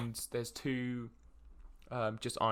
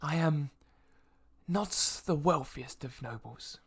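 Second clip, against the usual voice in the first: apologetic noble; 2 semitones higher, 3 dB quieter than usual. Acted. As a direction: admit modest means with polite dignity. Game master plays the noble.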